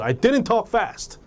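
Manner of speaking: acted indignation, pitched high and drawn out; angry denial. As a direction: protest slowly against the accusation. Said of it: "화가 나서 그러는 거예요". (화난 듯 높은 톤으로 항변)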